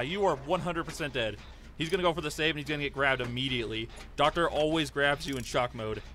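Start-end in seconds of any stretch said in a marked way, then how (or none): none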